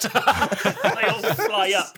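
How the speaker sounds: laughing. Real, not acted. Laughing at a joke.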